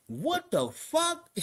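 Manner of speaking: high, long-drawn disbelief; retold incredulity. Inmate reacting to three hundred thirty bricks, drawn-out disbelief. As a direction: stretch it out high, as if stunned. Retold shock at huge amount.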